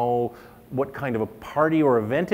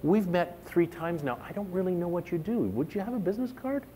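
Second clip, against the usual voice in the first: polite request; 6 semitones higher, 5 dB quieter than usual. Retold or imitated. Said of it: "connection and say".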